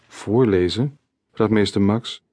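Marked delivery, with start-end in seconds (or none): none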